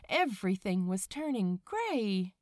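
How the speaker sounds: ordinary delivery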